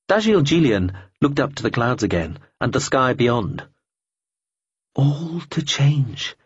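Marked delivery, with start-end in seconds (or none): 4.9-6.3 slow musing refrain. A narrator's reading. Repeated refrain.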